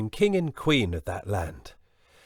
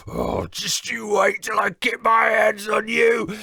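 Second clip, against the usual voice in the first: loud shouting giant; angry giant; 12 semitones higher, 7 dB louder than usual. Acted. Giant furious on shaking beanstalk, bellowing. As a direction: bellow the threat. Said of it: "said the giant".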